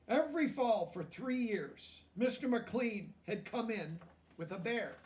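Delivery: lively storybook reading aloud; reading to children. A narrator's reading. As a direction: read it out to the class, bright and clear.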